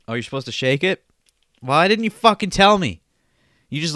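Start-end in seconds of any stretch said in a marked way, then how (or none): 1.6-3.0 angry yell. Acted. Yelling at Janice over drink.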